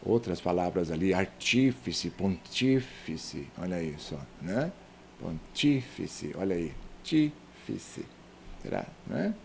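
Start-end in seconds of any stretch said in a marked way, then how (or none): 1.1-3.5 "artífice, pontífice" drawn out on TÍ; stressed TÍ. Shown as a demonstration. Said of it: "tem um erro que eu fiz". 5.2-8.0 "Pontífice" slow and low, leaning on TÍ; stressed TÍ. Shown as a demonstration. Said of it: "tem um erro que eu fiz".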